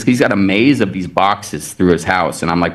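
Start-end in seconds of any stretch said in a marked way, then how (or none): none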